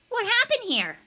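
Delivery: high, pointed echo of a question; bully's challenge. A narrator's reading. Josh echoing the teacher at Niles, high challenge.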